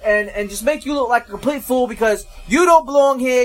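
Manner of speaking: hammed-up northern accent telling Georgians to go home; mock northern accent. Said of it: "my northern accent is probably not even spot on".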